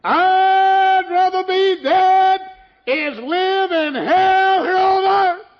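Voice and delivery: high, slow, loud anguished wail; wailing despair. Retold or imitated. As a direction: cry it out as if she'd rather die. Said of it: "And she said".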